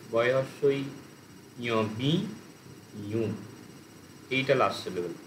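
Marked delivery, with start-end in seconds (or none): 1.8-3.3 বি, a clear pause, then ইউ; split into syllables. Shown as a demonstration. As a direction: separate বি from the last syllable ইউ. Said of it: "বিউর last syllable কি হচ্ছে?". (বি আর ইউ আলাদা করে, থেমে থেমে)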